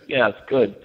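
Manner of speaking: usual speaking voice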